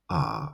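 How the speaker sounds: "a" held low and soft; third tone. Shown as a demonstration. Teacher modelling tones for repetition, "a" low and flat. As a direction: drop "a" to the bottom of the voice. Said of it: "it is a low".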